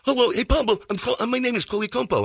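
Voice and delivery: high-voiced foreign exchange student; Coli Compo. Exchange student meeting him in his room, high foreign voice. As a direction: imitate an eager foreign student introducing himself. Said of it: "he talks like this".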